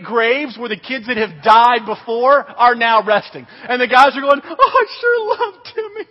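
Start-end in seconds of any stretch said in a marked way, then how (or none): 4.4-6.1 high, tearful mock lament; hammed-up grieving wail; mock mourning. Older campers mourning a buddy at fake graves, mock tearful.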